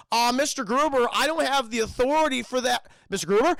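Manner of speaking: mock helpless protest down the phone; flustered official. Official telling Hans he lacks authority, mock flustered.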